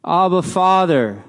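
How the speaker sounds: high, heartfelt cry of Abba, Father. Shown as a demonstration. Preacher shows the Spirit's cry to God, lifted high and earnest. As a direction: call out Abba, Father like a child reaching for dad. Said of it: "his spirit is leading me to say".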